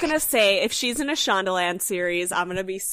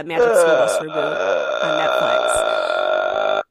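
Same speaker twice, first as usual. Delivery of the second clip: very low, slow, loud trailer voice; mock announcer; 7 dB louder, much slower than usual. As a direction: boom it out like a movie trailer. Announcing the reboot.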